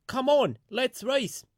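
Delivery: high, loud, impatient bus voice; rude shout. A narrator's reading. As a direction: blast it out rudely to clear the road. Bertie challenges Elizabeth.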